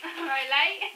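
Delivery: laughing. Pretend. Mocking the name Leighton.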